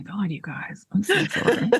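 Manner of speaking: hushed embarrassed apology; whispering. Real, not acted. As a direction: whisper the apology under breath. Whispered apology during hiccup fit.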